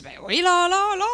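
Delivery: high, loud, comic fake confidence; hammed-up bluff. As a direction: bluff loudly that you speak it. Bluffe en faux arabe en entrevue. (bluff comique, fort et aigu)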